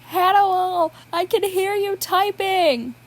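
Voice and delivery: Will calls down to Hannibal from upstairs, loud and high; calling from upstairs. Acted. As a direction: shout it up the stairs, half amused. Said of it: "came a voice from upstairs".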